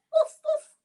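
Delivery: high, bright woofs; playful woofing; dog woofs. Pretend. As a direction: bark like a puppy. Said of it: "He says".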